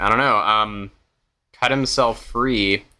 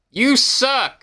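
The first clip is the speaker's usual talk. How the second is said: high-pitched mock yell; yelling in character; 11 semitones higher than usual. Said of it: "I yell at myself".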